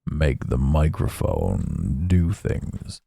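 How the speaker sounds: very low, slow, soft breathy murmur; mock ASMR murmur, low and slow. Shown as a demonstration. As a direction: hush right down into the mic like ASMR. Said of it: "was it A S M R".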